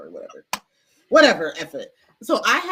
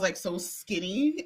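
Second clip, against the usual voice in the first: usual speaking voice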